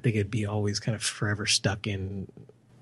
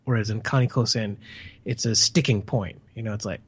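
ordinary delivery

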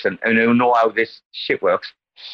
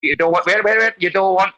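normal talking voice

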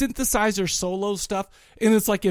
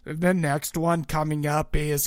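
slow, lower robotic monotone; drawn-out machine-voice announcement; robot stage announcer; 5 semitones lower than usual. Retold or imitated. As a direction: imitate a robot band introducing a song. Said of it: "that's kinda how they, like, talk".

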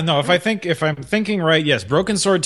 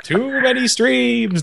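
laughing; 10 semitones higher, much slower than usual. Real, not acted. Joking about overloaded streams.

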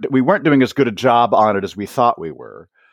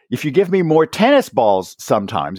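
ordinary talk

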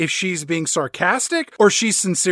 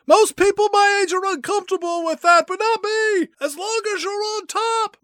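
hammed-up old Bond; 13 semitones higher than usual. Host voices an aging Bond hollering after fleeing women, hammed-up. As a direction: ham it up as a randy geezer shouting.

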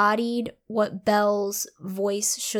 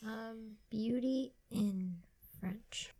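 whispering; 2 semitones lower, 13 dB quieter than usual. Real, not acted. Aside while looking something up.

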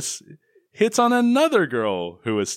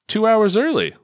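quoted line; 8 semitones higher, 5 dB louder than usual. Retold or imitated. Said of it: "That's his line".

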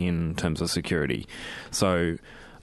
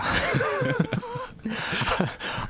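burst of laughter. Real, not acted. Laughing at own announcer voice.

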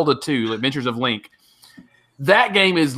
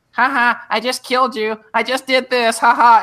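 high-pitched mock kid gamer; little prepubescent voice; 11 semitones higher, 3 dB louder than usual. Imitating a kid gloating over Halo, high little voice. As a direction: taunt in a small boy's high voice. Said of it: "this little prepubescent voice".

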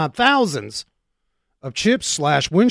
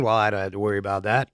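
mocking a listener; 10 semitones lower, 7 dB quieter, much faster than usual. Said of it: "Some of you are saying".

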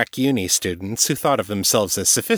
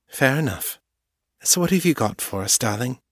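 lightly raised, wry and quick; understated Morgan, fond; a touch of playful Morgan; 4 semitones higher than usual. A narrator's reading. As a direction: tease her into business.

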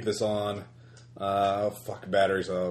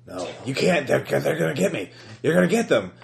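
panicked; 4 semitones higher, 4 dB louder than usual. Acted. Panicked figure on the recording.

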